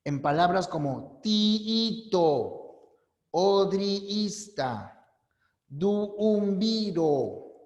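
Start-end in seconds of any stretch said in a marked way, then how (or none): 1.2-7.5 tiito, odriista, duumbiro with the doubled vowel drawn out long and slow; teacher drawing out the doubled vowels slowly; stretched double vowels. Shown as a demonstration. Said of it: "Deben ser iguales".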